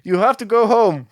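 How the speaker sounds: high, hammed-up Italian accent; scolding citizens in a jokey Italian accent; mock Italian accent. Mayor scolding people for going out, in a hammed-up Italian accent. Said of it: "This is my Italian accent".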